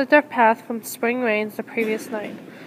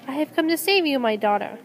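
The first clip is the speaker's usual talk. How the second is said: plain reading voice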